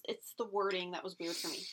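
usual speaking voice

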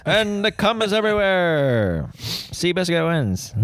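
high, drawn-out mock finish-line call; race announcer. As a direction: stretch out the winning call like a parody announcer.

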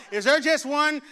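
preacher calling out. Retold or imitated.

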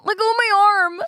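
high, loud pained yelps; arm twisted back. Acted. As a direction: yelp as if your arm's twisted. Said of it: "this is word for word".

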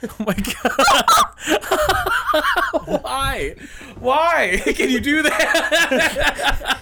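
high, gasping laughter through the words; real laughing fit, drawn out and high; helpless laughing. Real, not acted. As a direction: barely get the words out for laughing. Helpless laughter at the dog bark.

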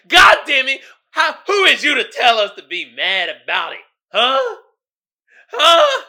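mock outraged crowd. Offended community yelling back at him.

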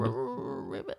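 low, soft rolling croak; rolled toad croak. Acted. Game master voicing the toad on the pillow, a low croak. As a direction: roll the r like a lazy toad.

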